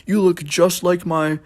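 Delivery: high, fawning mock old lady; gushing old manager. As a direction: fawn over the young customer. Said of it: "praise me and be all weird".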